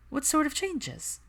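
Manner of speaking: high, slow, innocent question; feigned innocence. Acted. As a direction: play dumb, drawn out. Feigning ignorance to Theo.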